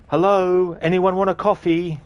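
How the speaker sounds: high, loud chirpy office voice; a cheerful coworker offering coffee; imitating Sean. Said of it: "hear him be like".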